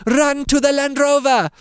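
high, loud put-on beekeeper voice; hammed-up urgent order, pitched high; beekeeper Simon. Simon ordering a run to the car, high hammed voice. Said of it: "He says".

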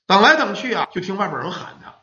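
usual speaking voice

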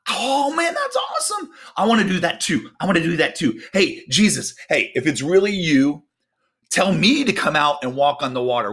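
high-pitched, giddy excitement; hammed-up thrilled shouts easing into eager chatter; eager Peter. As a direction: play an over-eager disciple begging to join in. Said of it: "And Peter, probably like me".

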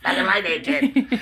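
high, loud hammed-up greaser voice; Fonzie. Veteran wrestler clowning for his host, exaggerated Fonzie voice. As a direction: play it up as a cool greaser, breaking into laughter. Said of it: "It sound like Fonzie now".

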